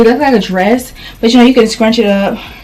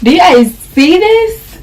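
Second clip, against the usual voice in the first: excited; 9 semitones higher, slower than usual. Real, not acted. Repeats it, showing off excitedly.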